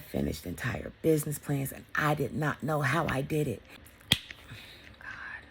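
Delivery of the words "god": a near-silent exhale of awe; whispered god. Real, not acted. Whispering to herself in disbelief. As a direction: breathe the word out softly. Emotional reflection.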